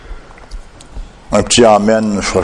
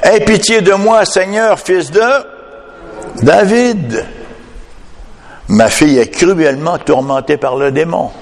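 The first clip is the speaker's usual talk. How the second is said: high-pitched imploring call, only mildly raised in volume; preacher's quoted cry, pitched well up; acted cry; 8 semitones higher than usual. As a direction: voice a mother's plea for mercy. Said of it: "lui cria".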